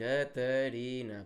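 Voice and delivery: Catarina drawn out very slowly, syllable by syllable; slow syllable count. Shown as a demonstration. To the co-host, counting the syllables, very slowly. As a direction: stretch out Catarina to count each beat. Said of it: "quatro sílabas".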